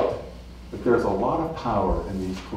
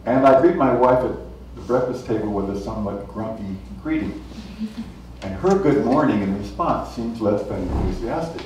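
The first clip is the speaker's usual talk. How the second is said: ordinary talk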